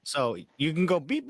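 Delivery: ordinary talk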